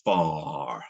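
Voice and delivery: FAR low and long, no R at the end; non-rhotic FAR. Shown as a demonstration. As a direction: draw out "far" with an open vowel and silent R. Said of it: "how do you pronounce the word".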